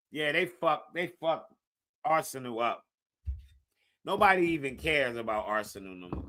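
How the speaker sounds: ordinary talk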